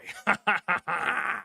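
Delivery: quick, soft cackle; gleeful laugh. Real, not acted. Fan crowing after trashing Dallas, gleeful cackle.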